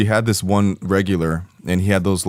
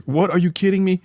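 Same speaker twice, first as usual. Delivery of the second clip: high-pitched retold disbelief; hammed-up, high incredulous question; mock incredulous; 12 semitones higher than usual. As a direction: act out his stunned protest, pitch jumping up. Retold client protesting an absurd order.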